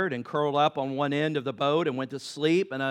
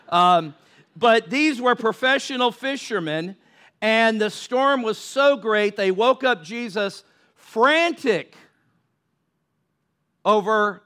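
higher and louder, driving the sermon; preaching at full force; 7 semitones higher, 6 dB louder than usual. Acted. Pastor driving the storm story home to the congregation, full-force preaching. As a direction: build the fear of the storm.

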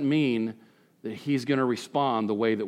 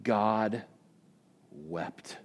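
hushed reverence; 3 semitones lower, 5 dB quieter than usual. Real, not acted. Preacher hushed after long pause.

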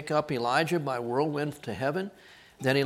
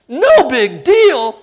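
slow, loud disbelieving echo; incredulous; 7 semitones higher, 13 dB louder, much slower than usual. Real, not acted. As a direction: stretch it out in disbelief. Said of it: "incredulous echo".

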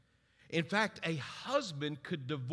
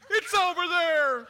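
higher, louder rattled reply through a laugh; rattled answer; 11 semitones higher, 9 dB louder than usual. Retold or imitated. Father still rattled telling his daughter where the bathroom is. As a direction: still half panicked, point her to the bathroom.

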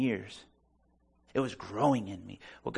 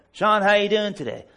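cheery call; 10 semitones higher, 10 dB louder than usual. Retold or imitated. Greeting the man a second time.

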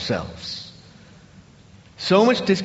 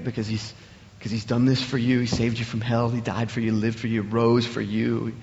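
ordinary delivery

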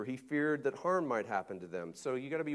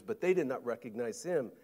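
ordinary talk, nothing marked

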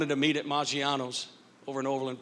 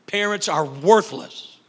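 exasperated teacher; 4 semitones higher, 9 dB louder, slower than usual. Retold or imitated. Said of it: "is what she said".

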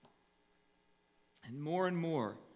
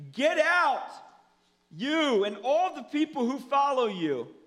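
loud, high-pitched shouted command; commanding outburst; 10 semitones higher, 12 dB louder than usual. Acted. Pharaoh's servants begging Moses to leave, acted commanding shout. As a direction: cry it out as a banishing order.

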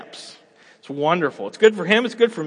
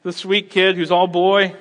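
ordinary delivery

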